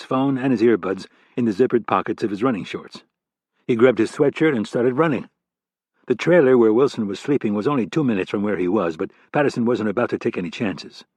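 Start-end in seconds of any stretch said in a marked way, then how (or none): none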